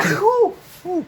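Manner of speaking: startled ooh. Real, not acted. Cough, then a startled exclamation.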